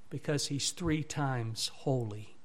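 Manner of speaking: ordinary talk, nothing marked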